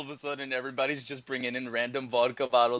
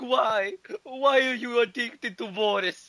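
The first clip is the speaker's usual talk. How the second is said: high, slow mock lament; mock wailing; 8 semitones higher, 4 dB louder, much slower than usual. Boris lamenting to the vodka, high and slow. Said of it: "He's like".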